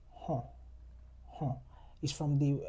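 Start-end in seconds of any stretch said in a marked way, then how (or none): 0.1-1.5 nasal open sound demo. Shown as a demonstration. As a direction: open jaw nasal huh. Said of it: "drop your jaw low and open your mouth wide".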